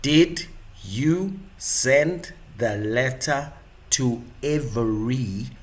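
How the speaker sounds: slow, drawn-out interrogating voice with a drop in pitch and level; lightly mock the slow, deliberate grilling; slow grilling questioner. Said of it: "speak slowly and you're like".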